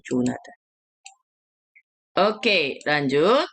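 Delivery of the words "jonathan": "Jonathan" said softly as jo-na-tan, Korean sounds; Korean-style Jonathan. Shown as a demonstration. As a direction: give "Jonathan" the Korean reading, quietly. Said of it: "Orang Korea tulisannya begitu". ("Jonathan" dilafalkan ala Korea)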